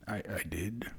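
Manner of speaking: nervous, whispered stutter; hushed, hesitant stutter caught off guard. A narrator's reading. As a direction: stammer it out under your breath. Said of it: "Nayland stuttered".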